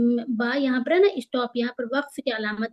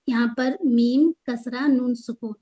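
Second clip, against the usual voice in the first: ordinary delivery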